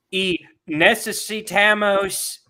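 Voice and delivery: mock debate candidate's Spanish; Beto. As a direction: labor loudly through the Spanish. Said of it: "he started speaking Spanish".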